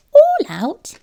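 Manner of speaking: high, loud squeal of disbelief; a mouse shocked by the name; surprised mouse. A narrator's reading. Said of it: "exclaimed the mouse".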